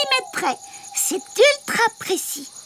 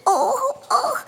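pained groan; 3 semitones higher than usual. Acted. Bat in pain, sick.

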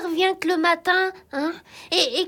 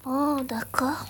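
low, slow, soft whisper; hushed, reluctant murmur; reluctant agreement; 5 semitones lower, 7 dB quieter, slower than usual. Acted. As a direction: give in slowly under your breath.

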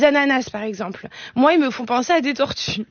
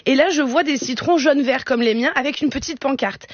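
ordinary talk, nothing marked

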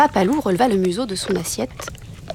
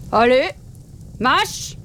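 commanding father wolf; 3 semitones higher, much slower than usual. A narrator's reading.

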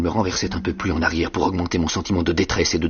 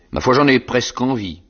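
higher and louder, a gleeful threat; menacing convict; 6 semitones higher, 4 dB louder than usual. A narrator's reading. Convict threatening to eat the boy's cheeks, menacing. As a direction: relish the threat like a hungry brute. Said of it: "avec un hochement de tête menaçant".

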